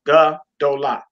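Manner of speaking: Ga, do, la in three separate beats; syllable by syllable. Shown as a demonstration. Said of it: "which is three syllables".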